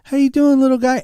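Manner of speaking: put-on high baby talk to a little bird; high sing-song greeting; cooing at a bird. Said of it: "I said".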